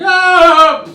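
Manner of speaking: sudden high yelp of pain; a stranger's piercing scream of pain. Retold or imitated. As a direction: let out a sharp shocked cry. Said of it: "a guy going".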